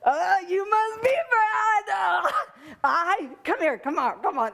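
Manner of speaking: hammed-up squeal, starting high and easing down; gushing meth-addict sister. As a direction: imitate an over-the-top sister pouncing on a newcomer. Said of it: "my younger sister comes, the meth addict".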